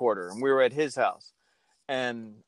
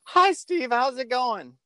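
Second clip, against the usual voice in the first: put-on much higher, like a twelve-year-old on the radio; boy's squeaky phone voice; squeaky kid voice; 12 semitones higher, slower than usual. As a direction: pitch it up like a nervous boy calling in. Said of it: "this is exactly how I sound".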